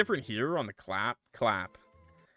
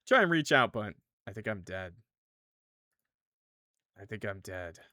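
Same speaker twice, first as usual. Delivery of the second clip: usual speaking voice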